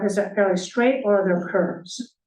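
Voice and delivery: ordinary talk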